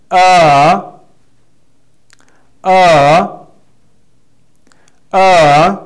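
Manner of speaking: "Uh" dipping slightly, then lifting; second tone, rising. Shown as a demonstration. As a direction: lift the end of a loud, high "Uh". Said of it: "The second one we say".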